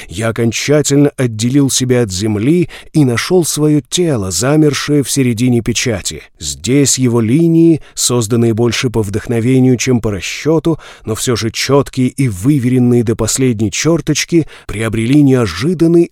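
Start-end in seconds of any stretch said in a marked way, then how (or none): none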